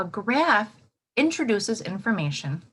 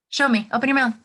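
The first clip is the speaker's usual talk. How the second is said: louder call to the class; 5 semitones higher, 6 dB louder, much faster than usual. Real, not acted. Teacher calling to pupils to show lost teeth, loud.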